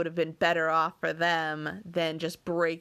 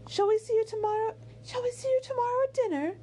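very high character voice; high, polite lady's voice; gracious hostess; faster than usual. A narrator's reading. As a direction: voice a hostess inviting, pitched way up. Voices Missus Dashwood in the novel.

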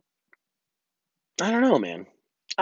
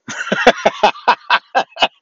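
hearty laugh; 9 semitones higher, 6 dB louder than usual. Real, not acted. Laughs at own joke.

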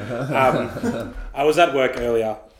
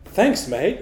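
sarcastic thanks; 7 semitones higher than usual. Retold or imitated. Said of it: "sarcastic thumbs up".

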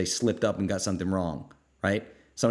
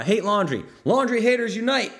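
much higher and louder rallying cry; excited readout; 13 semitones higher, 7 dB louder than usual. Real, not acted. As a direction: cheer it like a chant. Reading fan comment enthusiastically.

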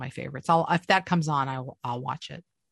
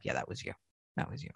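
low, soft correction; understated, quietly certain reply; matter-of-fact agent; 10 semitones lower, 14 dB quieter than usual. Retold or imitated. Agent answers the actor's denial, quietly matter-of-fact.

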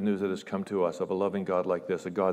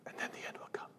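almost silent, grave hush; hushed solemn echo of the verse; solemn whisper; 17 dB quieter, faster than usual. Shown as a demonstration. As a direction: let it hang in a whisper. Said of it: "repeated whispered verse".

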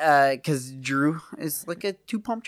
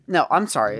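ordinary talk

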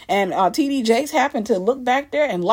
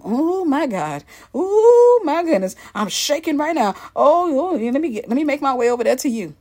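mock overwhelmed; 4 semitones higher than usual. Mocking a congregant called out by the pastor, hammed-up overwhelmed. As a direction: play someone theatrically overcome at being picked.

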